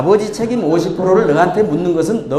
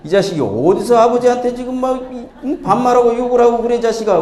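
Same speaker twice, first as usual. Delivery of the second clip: higher-pitched mock scolding; scolding father; 6 semitones higher than usual. Father scolding his kindergarten son in the bathhouse, mock angry. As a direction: tell off a cheeky child as an outraged dad.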